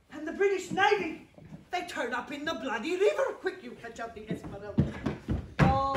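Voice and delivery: loud, projected theatrical captain, rallying the crew; boisterous captain cheering on his crew; stage convict captain. Acted. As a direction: play it big for the back row, a jolly ship's captain. Captain telling court in role.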